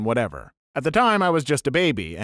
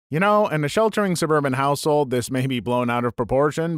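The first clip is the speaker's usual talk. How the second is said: ordinary talk, nothing marked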